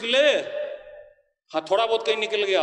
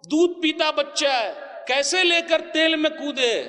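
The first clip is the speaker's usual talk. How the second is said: preaching shout; 6 semitones higher than usual. Real, not acted. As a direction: raise the voice on the mother's plight. Preacher cries out the story.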